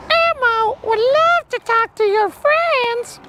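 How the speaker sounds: very high, slow puppet voice in the third person; Elmo voice. Pretend. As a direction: squeak it out like the little red monster. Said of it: "answer as Elmo".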